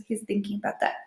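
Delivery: plain reading voice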